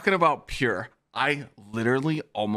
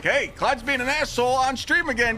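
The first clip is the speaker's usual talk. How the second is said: much higher, hyped gossip voice; imitating chatters pitched way up; mock chat voice; 13 semitones higher than usual. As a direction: play chatters spreading the news. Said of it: "It's like".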